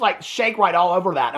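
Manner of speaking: usual speaking voice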